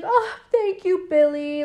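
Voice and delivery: high, put-on theatrical thanks; stage performer. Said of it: "And he's like".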